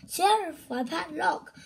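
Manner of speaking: loud high cry for the sheriff; shouting for help. Acted. A character crying out to the sheriff, loud and high. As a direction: call out to the sheriff in alarm.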